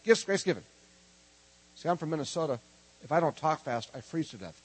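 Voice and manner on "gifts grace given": quick run through the three words; said faster. Shown as a demonstration. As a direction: rattle it off briskly, raised voice. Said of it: "Let's say it faster".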